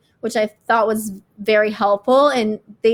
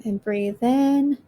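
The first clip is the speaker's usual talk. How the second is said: slow, calm breathing guide; guided meditation. Acted. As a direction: ease them through each breath.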